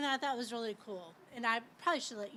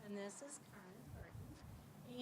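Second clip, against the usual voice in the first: normal talking voice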